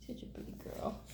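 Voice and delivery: whispered praise to the cat; soft whisper. Real, not acted. Owner whispering praise to her pretty cat. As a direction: coo it in a whisper.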